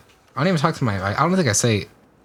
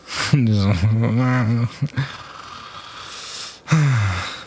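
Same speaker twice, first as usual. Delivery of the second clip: drawn-out laugh fading into breath; long, breathy laugh at himself. Real, not acted. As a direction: laugh it out, then trail off. Laughs after telling his rejection.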